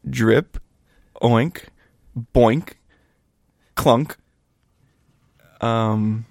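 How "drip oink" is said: ordinary talk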